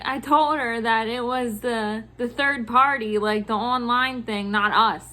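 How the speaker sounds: ordinary talk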